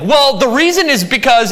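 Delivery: high, loud whiny "Well, the reason is because"; mock defensive excuse. Preacher mocking listeners' defensive excuses, high and loud. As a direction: mimic a churchgoer making excuses.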